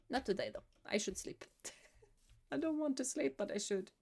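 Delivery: ordinary talk, nothing marked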